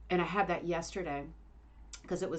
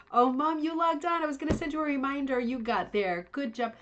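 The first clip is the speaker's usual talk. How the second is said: high, loud happy greeting; delighted to see her mom; excited; 7 semitones higher, 5 dB louder than usual. Real, not acted. Mom joins the live stream.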